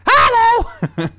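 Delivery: squeaky, very high and loud; tiny mushroom yelling hello; Toad voice. Pretend. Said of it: "Do your best Toad voice".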